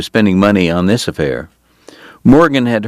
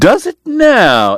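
much higher, slow, excited Irish voice; Eddie cries out; 4 dB louder, slower than usual. Acted. Eddie, thrilled to be kin, cries out eagerly. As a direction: leap up in delighted surprise. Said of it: "Eddie cried".

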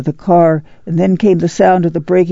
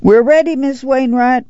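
high-pitched carrying call; 8 semitones higher than usual. A narrator's reading. Ma tells the neighbor they are ready, calling out. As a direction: sing out to the neighbor. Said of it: "Ma called".

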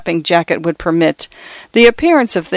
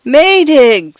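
high, louder feeble scream; screaming a name; 8 semitones higher, 4 dB louder than usual. A narrator's reading. Mister Fotheringay calling Maydig in the storm, screaming. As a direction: scream for help into the gale. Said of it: "screamed Mister Fotheringay's feeble voice".

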